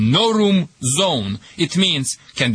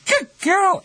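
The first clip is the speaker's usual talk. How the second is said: put-on "Good girl" sung out much higher as praise. Shown as a demonstration. Trainer praises the dog once out: very high "Good girl". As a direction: squeal "Good girl" up high to reward her. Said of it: "you start to say".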